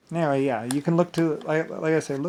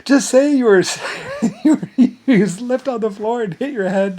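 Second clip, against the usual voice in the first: high, loud, giggling quote; laughing advice; 11 semitones higher, 12 dB louder than usual. Retold or imitated. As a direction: barely get it out for laughing. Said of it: "I said".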